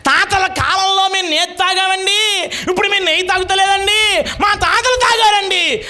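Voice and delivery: rising hammed-up pulpit shout; repeated preacher quote. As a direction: repeat it bigger, shouting it to the hall. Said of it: "మళ్ళీ చెప్పనా? చెప్తాను వినండి".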